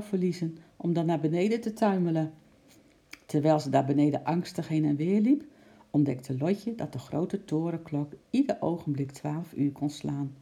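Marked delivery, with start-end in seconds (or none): none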